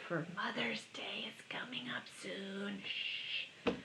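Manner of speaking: hushed whisper with a shush; secretive whisper. Pretend. Mother's Day secret kept from moms.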